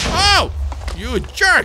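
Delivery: high, loud indignant yell; outraged shout. Real, not acted. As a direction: yell at a friend's trick. Caught out by Nico's trap.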